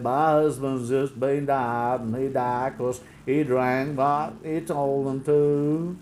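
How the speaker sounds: slow, dopey mock voice; mock widow. The widow complaining on TV, slow, dopey imitation. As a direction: drag it out like a simpleton. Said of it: "she came on and she said".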